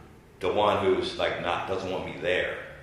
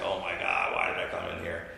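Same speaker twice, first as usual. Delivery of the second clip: much lower, dazed mock voice of the mayor; stunned mayor; 14 semitones lower, 5 dB quieter than usual. The governor mocks the mayor stuck on live TV, dazed. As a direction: freeze up like a deer in headlights. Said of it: "just like a deer in the headlights".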